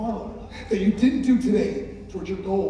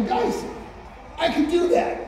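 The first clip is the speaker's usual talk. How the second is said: excited realization; 9 semitones higher than usual. Retold or imitated. Aha moment voiced in story.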